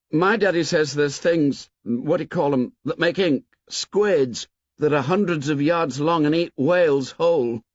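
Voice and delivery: put-on a small boy reasoning it out; earnest schoolboy. A narrator's reading. Boy boasting about sea monsters.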